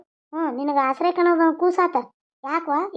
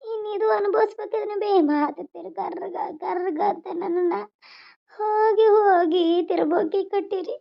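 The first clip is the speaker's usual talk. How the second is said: put-on higher, slow, tearful young mother's lament; a weeping new mother, voice higher and drawn out; crying daughter; 4 semitones higher, slower than usual. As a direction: plead through tears to your mother.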